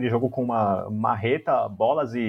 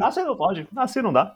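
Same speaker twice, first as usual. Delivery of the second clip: higher-pitched politician imitation; playful higher mock-presidential voice; Fernando Henrique Cardoso; 8 semitones higher than usual. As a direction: lightly mimic a statesman's objection, pitched up. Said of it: "ele tá imitando o Fernando Henrique Cardoso".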